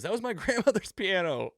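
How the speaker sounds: lightly imitating a miffed beatnik; mock indignant spectator. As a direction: whine about the lost piano in mock outrage. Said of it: "that guy goes".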